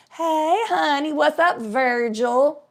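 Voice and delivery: slow, familiar hello in an imitated voice; put-on voice of a woman hailing someone she knows; woman greeting an old flame. Woman greeting dad in story.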